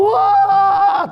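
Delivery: very high, loud frightened yelp; scared shout. Acted. Disciples scream at the figure on the water, frightened yelp. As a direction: yelp in terror. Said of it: "takut teriak-teriak".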